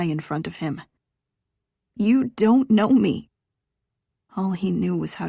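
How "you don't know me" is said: slow, loud, pointed denial; defiant. Acted. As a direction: plant each word firmly. She pushes him away emotionally.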